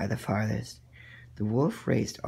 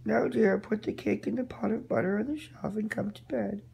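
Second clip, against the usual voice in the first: muffled voice; 11 semitones higher than usual. Acted. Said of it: "said in a muffled voice".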